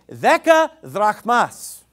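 "Deka drachmas" read out slow and loud, Greek-style; Greek pronunciation. Shown as a demonstration. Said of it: "it's gonna say".

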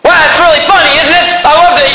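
high-pitched sarcastic delight. Real, not acted. Preacher mocks a weekend marriage to his congregation, sarcastically.

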